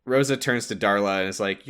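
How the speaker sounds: ordinary delivery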